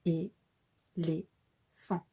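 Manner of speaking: each syllable detached, with a pause after each. Shown as a demonstration. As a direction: tap out É, lé, fan one at a time. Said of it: "en rapprochant nos doigts du menton". (syllabe par syllabe, bien détachées)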